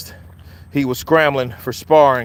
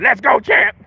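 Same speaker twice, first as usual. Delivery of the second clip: fan in the crowd; 9 semitones higher than usual. Retold or imitated. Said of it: "somewhere out there".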